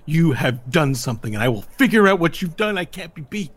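villainous indignant protest; defiant sore loser. Acted. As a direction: refuse to accept defeat, accusing your rival. Beaten character protests his defeat.

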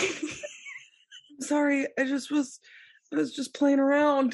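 high, hurried pleading apology; mock-apologetic sister. S1 voicing the sister apologising for the orca jab, high and pleading.

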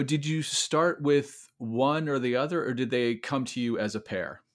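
ordinary talk, nothing marked

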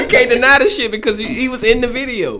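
high, loud, through real laughter; laughing. Real, not acted. Friend reacting to the beating video, laughing hard. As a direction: crack up while saying it.

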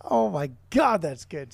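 normal talking voice

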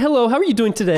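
high-pitched put-on greeting; mock polite voice. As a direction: greet in a high, fake-proper voice. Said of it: "Do you say".

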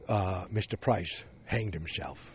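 lightly voiced old man delivering bad news gently; old partner quietly telling the staff of a death; Bert Cooper breaking news. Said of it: "is what Bert Cooper said".

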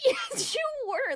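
high, drawn-out mock contradiction; stretched, high-pitched "oh come on" retort; incredulous retort. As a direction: squeal the comeback in disbelief. Own retort to retold denial.

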